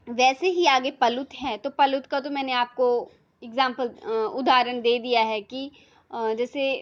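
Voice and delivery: ordinary talk, nothing marked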